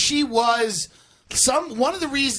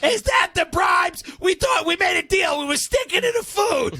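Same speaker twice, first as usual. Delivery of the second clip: hammed-up high shouting match; mock quarrel; 8 semitones higher than usual. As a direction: shout back at the spouse. Couple's quarrel acted.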